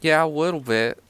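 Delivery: put-on high little-kid voice; youngest child. Troubled young master answering a visitor, small child voice. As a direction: pitch it up like a small boy. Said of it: "the youngest one".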